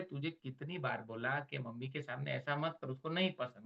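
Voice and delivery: soft, low-voiced scolding of a son; quiet private scolding. Father scolding his son alone, quietly. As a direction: scold gently, out of mom's hearing. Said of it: "मैं उसको अलग लेके जाता हूँ".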